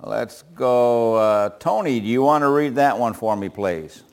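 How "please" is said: ordinary talk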